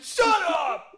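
high, loud, laughing yell; mock defensive yell. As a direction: play the homeless guy snapping back. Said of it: "He's like".

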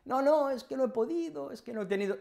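mock excuses. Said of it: "y me dice".

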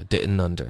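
"didn't under" as di'n-nunder; t dropped. Shown as a demonstration. Teacher modelling for practice, "didn't under" without t. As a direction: drop the t linking "didn't under". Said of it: "这个特的音也会省略".

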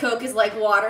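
ordinary talk, nothing marked

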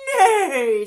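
high, loud, falling whinny; whinny out like a horse; horse neigh. A narrator's reading. Horse joining the farmyard chorus, loud high neigh. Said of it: "said the horse".

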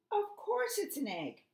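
high-pitched, huffed retort; storybook duck in a huff; huffy. A narrator's reading. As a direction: huff it out high, offended at being told. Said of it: "huffed Duck".

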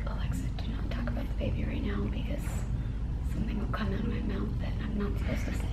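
hushed whisper to herself. Retold or imitated. Telling herself not to reveal the baby's gender, whispered. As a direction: whisper a warning so no one hears.

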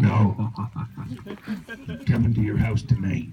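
creepy monster voice. Pretend. Spooky threat voice again.

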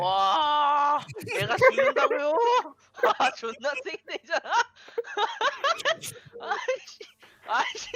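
high-pitched laughing disbelief; laughing panic. Real, not acted. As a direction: laugh in shocked frustration. Dying in game, laughing panic.

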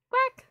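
very high squeaky duck quack; high cartoon duck quack. Imitating the duck alert sound.

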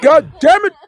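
laughing frustration. Acted.

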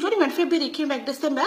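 high, fast shocked outburst. Retold or imitated. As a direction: gasp it out in disbelief. Auntie shocked at nephew's change.

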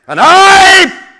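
huge high shout; testing the echo. Acted. Tiger testing the echo, very loud high shout. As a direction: bellow it out to make the room ring. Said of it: "said the tiger, just testing the echo".

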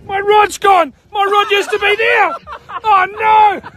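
yelling. Real, not acted. Angler who just lost his rod, yelling. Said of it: "Why are you two yelling".

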